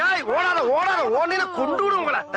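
high-pitched warning call; urgent shout. Acted. Man calls after people running from the dog, urgent shout.

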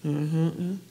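mock dismissive uh-huh grunt; imitated grunt. Comic imitating a rude clerk's dismissive grunt. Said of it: "and I'm quoting".

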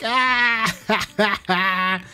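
imitated laughing. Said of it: "She's like".

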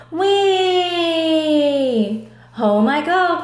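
high, loud whooping glee; storybook cheer; gleeful cry. Acted. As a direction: whoop with joy flying home. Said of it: "cried Doug".

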